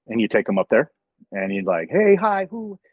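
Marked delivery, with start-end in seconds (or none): 1.9-2.8 high-pitched imitated hello; put-on high, chirpy hi; mock excited greeting. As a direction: act out a little high squeal of greeting. Acting out greeting the celebrity.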